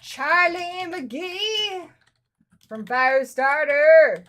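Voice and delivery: very slow, stretched-out syllables; drawn-out list entry. Real, not acted. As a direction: draw each word out as if writing it down. Saying name while listing it.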